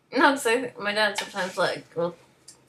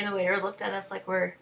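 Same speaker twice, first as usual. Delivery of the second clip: ordinary delivery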